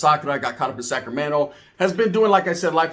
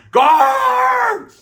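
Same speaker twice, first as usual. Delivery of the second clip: high, loud desperate yell; a desperate yell for help; shout for guard; 7 dB louder than usual. Retold or imitated. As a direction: scream for the guard through the door. Calling the guard from the cell.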